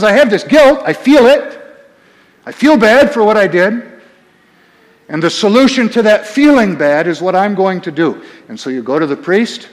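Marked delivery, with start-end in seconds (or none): 0.0-3.8 higher, louder, mildly hammed-up guilt; acted penitent. Preacher voicing a sinner who feels guilt, acted urgency. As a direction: insist on the guilt like an earnest penitent.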